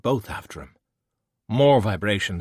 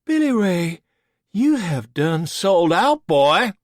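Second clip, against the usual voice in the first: high, loud, slow hammed-up Texan accent; Billy Ray, you have done sold out, boy, in an exaggerated cowboy drawl; mocking an old friend with an overdone Southern accent; 10 semitones higher, 6 dB louder, slower than usual. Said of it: "What happened to the accent?".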